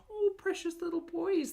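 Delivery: high doting coo over pets. Real, not acted. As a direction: gush over tiny frogs. Reacts to viewer's frogs.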